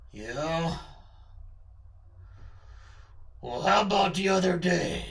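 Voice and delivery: suspicious put-on character voice; lightly menacing, accusing; Charlie's menacing voice. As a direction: challenge them in a character voice. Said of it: "that voice does sound menacing".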